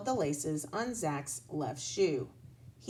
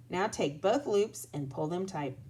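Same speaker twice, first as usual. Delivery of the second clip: ordinary delivery, nothing marked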